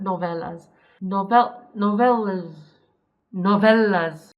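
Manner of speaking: "Novellas" said carefully, no-VEL-las; pronunciation fix. Shown as a demonstration.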